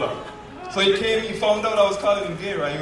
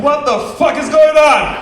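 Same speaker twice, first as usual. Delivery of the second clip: high, loud what the fuck; bewildered outburst; 10 semitones higher, 8 dB louder than usual. Retold or imitated. Teller retelling his panic at the laughing crowd, high startled outburst. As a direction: panic at the whole room laughing.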